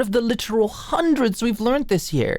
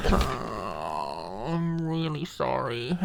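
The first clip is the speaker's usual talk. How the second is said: quiet apology; 7 semitones lower, 8 dB quieter, much slower than usual. Acted. Apologizes after getting worked up.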